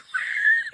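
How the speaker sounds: loud, high-pitched laugh; burst of laughter. Real, not acted. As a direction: explode into real laughter. Bursts out laughing at own joke.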